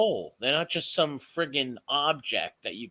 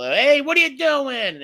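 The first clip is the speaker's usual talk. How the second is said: much higher, louder, slow scolding call; sharp yell at the dog; 12 semitones higher, 7 dB louder than usual. Shown as a demonstration. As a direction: stop the dog in its tracks with your voice. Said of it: "just my voice alone, he'll stop".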